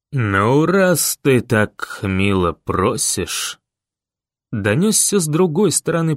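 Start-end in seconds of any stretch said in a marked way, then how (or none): 0.1-3.6 low, slow, drawled taunt; unhurried villain. A narrator's reading. Rider across the square to pleading boy, unhurried taunt. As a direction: take your time, no need to shout. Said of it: "даже не потрудившись подняться до крика".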